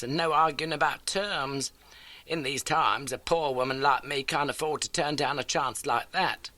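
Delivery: a lightly voiced elderly landlady; homely, eager old lodging-house keeper. A narrator's reading. Landlady greets Holmes, lightly voiced homely.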